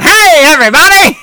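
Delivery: high, loud hammed-up greeting; mock his big announcer hello; mock loud host. Wife mocking her husband's loud podcast greeting. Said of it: "instead of going".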